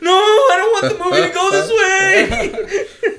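slow, high, loud mock wail; anguished protest. Viewer falling out of chair, anguished protest. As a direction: howl it like a comic meltdown.

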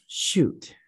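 breathy whispered "shoot"; whispered curse. Real, not acted. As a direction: hiss it under your breath. Muttered curse after a slip.

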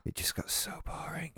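secret whispered aside. Real, not acted. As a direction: whisper it behind a hand. Whispered aside then repeated aloud.

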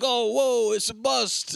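high, hammed-up whoa; mock surprised crook. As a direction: play a startled crook. Gangsters react in retelling.

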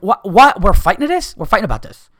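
can't believe you're fighting about this; incredulous outburst. Real, not acted. Disbelief at fighting over food.

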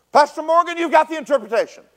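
loud, high-pitched mock proclamation; mimicked call-out. Prayer leader calls on the pastor before the group, shouting. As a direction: belt it out high, imitating a zealous prayer leader. Imitates Lynn calling on him.

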